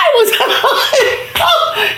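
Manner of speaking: high laugh through the words; laughing in delight while telling; a storyteller breaking into laughter. Real, not acted.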